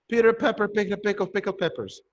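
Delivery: brisk, unbroken run of the twister; quick tongue twister. Shown as a demonstration. Same tongue twister repeated faster.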